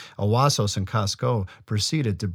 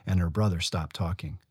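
usual speaking voice

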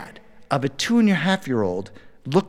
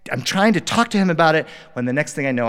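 usual speaking voice

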